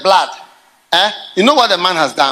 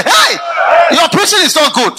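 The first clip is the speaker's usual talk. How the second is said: mock shout; 8 semitones higher, 4 dB louder than usual. Members shouting at pastor.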